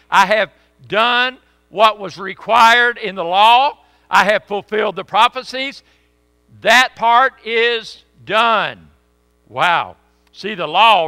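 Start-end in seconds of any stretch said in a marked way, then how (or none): 0.1-8.9 slow, loud triumphant declaration; proclaiming loudly. Acted. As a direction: preach it like a victory cry. Jesus' triumphant words, preached.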